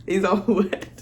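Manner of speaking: ordinary delivery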